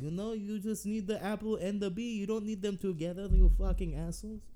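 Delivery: high, loud, outraged French rant; angry French rant. Pretend. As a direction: yell it like an offended Frenchman. Ranting as angry French person.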